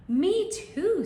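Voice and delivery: high squeaky mouse voice. Acted. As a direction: pipe it up as a tiny mouse.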